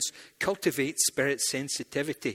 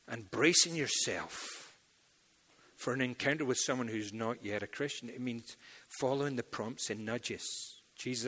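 ordinary talk

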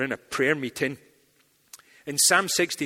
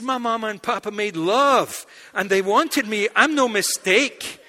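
mock indignant protest; 6 semitones higher, 7 dB louder than usual. Indignant reply.

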